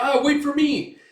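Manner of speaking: high, loud plea; panicked squeak. Retold or imitated. Small companion left behind, high panicked cry. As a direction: scramble to keep up. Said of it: "He's like".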